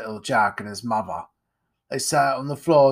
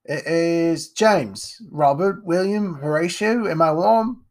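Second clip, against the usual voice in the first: lightly voiced poor mother, pitched higher; a hint of a scolding mother; Jack's mother; 6 semitones higher, 5 dB louder, slower than usual. Mother answering the servant, lightly voiced and raised.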